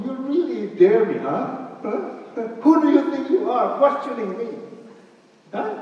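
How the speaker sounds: mock offended rebuke; mock angry God. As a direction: play God bristling at being questioned, half in jest. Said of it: "I would go".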